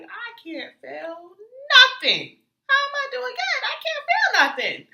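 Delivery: high, loud, laughing disbelief; incredulous laughing protest. Retold or imitated. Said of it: "And I'm just like".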